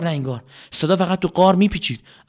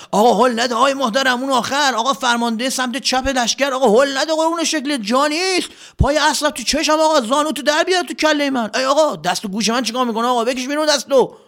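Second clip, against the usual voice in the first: high, squabbling crowd voices; high bickering soldier voices; quarrelling soldiers; 12 semitones higher than usual. Acted. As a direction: jostle and complain like squashed soldiers.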